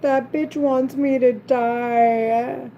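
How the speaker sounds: slow, drawn-out mock quote; mocking, dragged-out singsong; mocking imitation. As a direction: stretch the words out to imitate someone jeering. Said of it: "She's mocking me. She's like".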